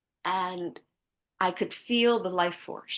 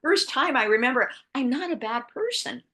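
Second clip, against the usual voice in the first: excited outburst; 7 semitones higher than usual. Retold or imitated. Excited realization told to dad.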